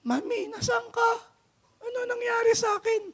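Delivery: put-on a boy waking up, calling for mommy; confused child. Revived son calls his mother.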